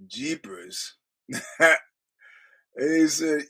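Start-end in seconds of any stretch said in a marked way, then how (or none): 0.1-1.0 soft, whispered "Jeepers" with a rising end; mock breathy jeepers. Host mimicking the mobster saying jeepers, near whispered. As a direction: toss off "Jeepers" almost voiceless. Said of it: "Xavier Gravano goes".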